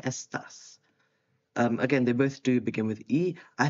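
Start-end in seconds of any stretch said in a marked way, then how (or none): 0.0-0.8 "estás" whispered, the stress on the a of tás. Shown as a demonstration. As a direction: breathe "estás" softly, leaning on the last syllable. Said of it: "accent on the A there".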